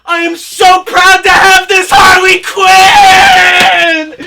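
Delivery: loud, high, slow teary mock sobbing; hammed-up crying joy. Collector gushing over Harley Quinn figure, hammed-up crying shout. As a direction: overwrought fangirl weeping with joy.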